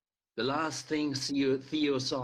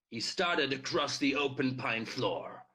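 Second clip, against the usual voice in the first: plain reading voice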